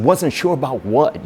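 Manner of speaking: mildly confrontational questioning; imitated aggression. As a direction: retell a pushy nurse demanding an answer. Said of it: "he's getting aggressive with me".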